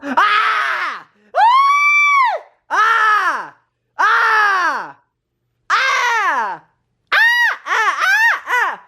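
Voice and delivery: high frightened shrieks; a run of panicked shrieks; scared screams. Acted. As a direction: scream at the gorilla. Screaming at the gorilla.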